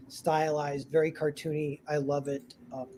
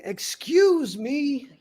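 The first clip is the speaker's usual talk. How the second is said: very slow, higher, pointedly drawn out; haughty excuse me; 10 semitones higher, much slower than usual. Acted. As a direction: drag it out as a snub. Haughty guest pushes past.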